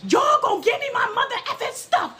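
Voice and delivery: very high, screechy demand; hammed-up high-pitched tantrum; mock screaming. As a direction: imitate a woman storming in screaming. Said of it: "she comes in screaming".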